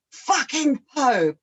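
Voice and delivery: angry husband. Retold or imitated. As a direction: drag out the swear in disgust.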